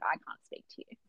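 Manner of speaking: low, voiceless mock huff; hushed indignation. Fan refusing to talk to the author, hushed indignation. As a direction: drop to a near whisper, affronted. Retold huffy reply to the author.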